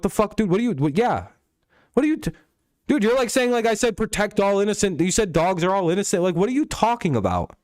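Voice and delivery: can't believe what he's hearing, push it up; exasperated outburst. Real, not acted. Heated argument with Josh.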